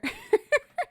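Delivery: high squealing laugh; high burst of laughter. Real, not acted. Reacting to the lead singer's refusal, a high squealing laugh. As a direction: laugh out loud, high and sudden.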